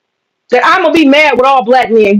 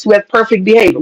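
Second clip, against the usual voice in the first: normal talking voice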